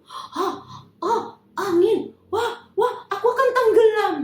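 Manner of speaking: high, loud alarmed cries; sudden fright at sinking; panicked fear. Acted. Peter feeling the wind and sinking, high panic.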